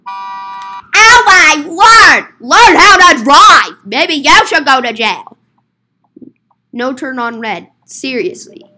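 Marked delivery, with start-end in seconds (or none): 0.1-5.4 loud road-rage outburst, opening drawn out; exasperated yelling. Pretend. Yelling at another driver, loudly exasperated. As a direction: yell at a bad driver.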